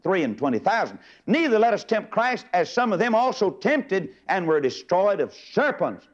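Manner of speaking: high, driving sermon cadence; climbing pulpit fervor on every line; fervent preaching. Real, not acted. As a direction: preach the verses with rising fire. Preaching scripture at full force.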